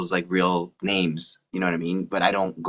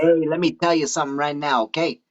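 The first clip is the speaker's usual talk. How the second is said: put-on higher-pitched New York movie-mobster accent; New York mafia accent; 7 semitones higher than usual. Movie mobster laying down the law, New York accent. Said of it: "the mafia movies".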